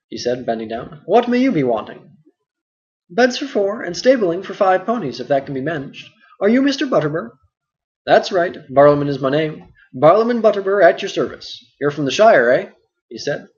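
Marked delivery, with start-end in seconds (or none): 1.0-2.2 a higher, hearty innkeeper voice; busy innkeeper. Acted. Innkeeper asks. 3.1-7.3 put-on a lightly higher, puzzled young hobbit; Frodo, mildly questioning; Frodo's voice. Frodo asks innkeeper. 8.0-12.7 a louder, bustling landlord voice; hearty and full of chatter; talkative innkeeper Butterbur. Acted. Butterbur introduces himself.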